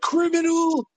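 high, mocking cry of an accuser; mock accuser's yell. Facebook trolls jeering at the indicted dad, high mock cry. Right-wing people posting on Facebook.

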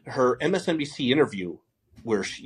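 ordinary delivery

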